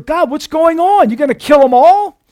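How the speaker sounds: high, alarmed plea to God; imitating Ezekiel's alarmed plea; pleading cry. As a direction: cry out as the prophet. Voices Ezekiel crying to God.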